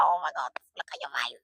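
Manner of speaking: whisper. Retold or imitated. Admiring cousin's eyes.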